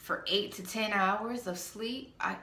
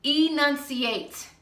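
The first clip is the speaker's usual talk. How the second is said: louder, higher and slow, every syllable spelled out; firm order; 7 semitones higher, 5 dB louder, slower than usual. Real, not acted. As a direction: drill the word into them. Ordering mumblers to speak clearly.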